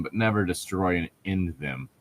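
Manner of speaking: ordinary talk, nothing marked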